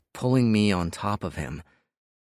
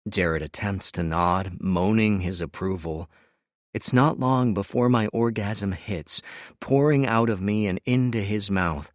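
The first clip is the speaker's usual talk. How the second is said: usual speaking voice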